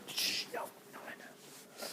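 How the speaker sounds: softly shushing yourself; a quick hushed no no; hushing whisper. Real, not acted. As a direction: whisper to take the joke back. Hushing after a rude joke.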